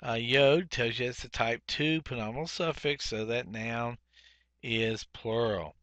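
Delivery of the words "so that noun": ordinary delivery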